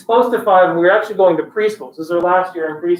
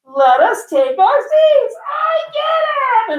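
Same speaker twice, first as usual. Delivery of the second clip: put-on high, slow, drawn-out kid's squeal; imitating an ecstatic daughter's revelation; excited little kid; 12 semitones higher, much slower than usual. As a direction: stretch each word, building to a shriek. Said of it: "she's so freaking, like, she just won".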